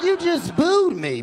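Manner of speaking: high-pitched mock indignation; indignant protest. Protests to man who booed him.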